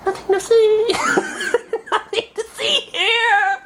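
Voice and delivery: high giggly mock innocence; laughing through it. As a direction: try to sound innocent while cracking up. Hiding, caught, laughing.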